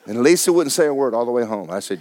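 ordinary delivery

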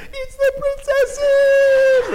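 very high, drawn-out excited squeal; little boy squeal. Retold or imitated. Son imitated spotting the princesses, high slow squeal. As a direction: stretch it out like a thrilled little boy. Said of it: "And Jackson's like".

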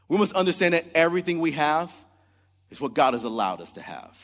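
usual speaking voice

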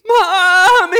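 high, loud crying lamb call; lost lamb crying. Acted. Lost lamb crying for its mother, high loud bleat. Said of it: "next thing we hear".

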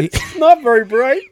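high, laughing remark. Real, not acted. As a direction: say it through real laughter. Laughing at the absurd email.

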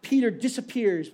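usual speaking voice

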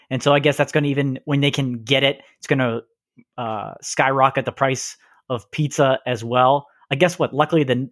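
ordinary talk

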